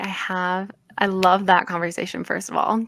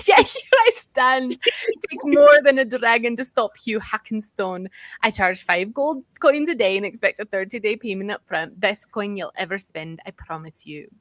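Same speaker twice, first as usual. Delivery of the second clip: put-on Scottish accent. Dwarf guide closes his pitch to adventurers, attempted Scottish accent. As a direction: declaim in a Scottish accent, easing off at the end. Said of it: "try and do a Scottish accent".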